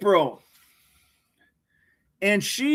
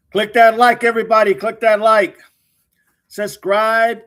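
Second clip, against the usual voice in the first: loud plug; 4 semitones higher, 7 dB louder than usual. Real, not acted. Streamer urges viewers to like.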